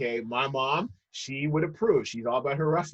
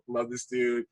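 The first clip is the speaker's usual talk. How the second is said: ordinary talk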